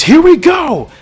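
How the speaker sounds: high, loud answering yell; acted heroic war cry; battle shout. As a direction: launch the attack with a yell. Acted battle reply to rallying shout.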